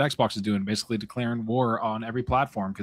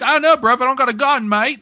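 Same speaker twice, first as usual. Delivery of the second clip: high, loud mock British gamer voice; mock British accent; 13 semitones higher, 8 dB louder than usual. Mocking his British teammate without a gun, loud high British accent. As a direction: ham up a cheeky British lad. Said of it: "you kinda got the accent".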